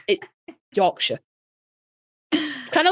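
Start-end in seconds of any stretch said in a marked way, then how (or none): 0.8-1.2 Yorkshire with short O, york-sha. Shown as a demonstration. Teaching the short O in Yorkshire. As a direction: clip the O in Yorkshire. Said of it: "you don't need to elongate that O".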